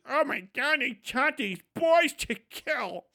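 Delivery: high, slow mocking civilian voice; mock the horrified onlooker with drawn-out dismay; milquetoast outsider. Civilian reacting to soldiers, mock horrified whine. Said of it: "give these milquetoast city answers".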